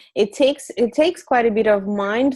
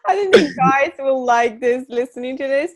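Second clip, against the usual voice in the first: higher, louder, laughing through the words; 6 semitones higher, 5 dB louder than usual. Real, not acted. Guest laughing as she reacts to the puppy lesson.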